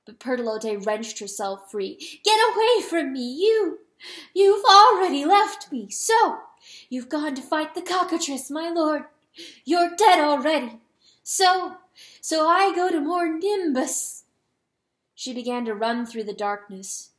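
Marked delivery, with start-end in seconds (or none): 2.2-14.2 loud, high, bitter outburst; furious rejection. A narrator's reading. Hen tearing free of the rooster, furious. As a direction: drive him off with raised voice.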